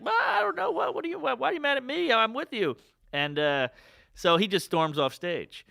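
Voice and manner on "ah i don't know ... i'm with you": very high, confused airhead voice; ditzy heckler. Retold or imitated. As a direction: play the heckler baffled and hurt. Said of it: "she was just like".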